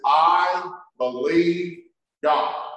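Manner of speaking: "I believe God" drawn out slowly, "I" stressed; slow emphatic stress. Shown as a demonstration. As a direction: drag it out, each word weighty. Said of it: "He says".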